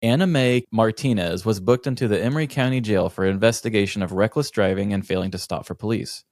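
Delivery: usual speaking voice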